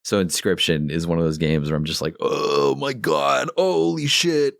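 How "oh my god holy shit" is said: awestruck. Retold or imitated. Player recalling a game's big twist, awestruck. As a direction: stretch it out, then jump up high in amazement. Reacting in awe to the game.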